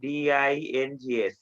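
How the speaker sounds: slow, measured reading for note-takers, then spelled out letter by letter; slow dictation. Real, not acted. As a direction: dictate slowly, word by word. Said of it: "আমি আস্তে আস্তে বলতেছি". (খাতায় লেখার জন্য আস্তে আস্তে বলা)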